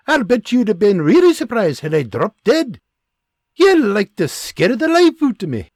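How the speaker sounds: a wife scolding her husband for a cruel prank; angry scolding. Acted. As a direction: give him what for.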